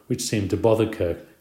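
plain reading voice

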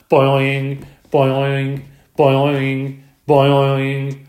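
loud, springy, repeated twang; twanging frog boing. A narrator's reading. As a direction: bounce each boing like a spring. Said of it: "Og twanged loudly".

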